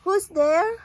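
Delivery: high, loud frightened cry; mouse cries out. A narrator's reading. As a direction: cry out in alarm. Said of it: "Mouse cries".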